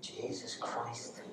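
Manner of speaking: slow, whispered, very soft; nervous whisper. Real, not acted. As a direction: whisper it to yourself, nervy. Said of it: "your body is very nervous".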